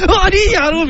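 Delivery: high-pitched excited fanboy outburst; acted starstruck exclamation; mock fan excitement. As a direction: gasp it high like a thrilled fan. 男子ファンの興奮を演じる.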